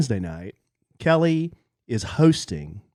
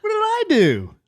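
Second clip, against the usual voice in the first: much higher, hammed-up whine of innocence; whiny high voice; 8 semitones higher than usual. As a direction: play the wronged husband, squeaky. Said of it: "Why do I talk like this?".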